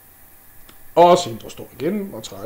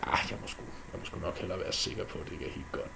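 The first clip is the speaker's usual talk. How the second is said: sneaky whisper; 9 semitones lower, 13 dB quieter, much faster than usual. Acted. Loke plotting to himself.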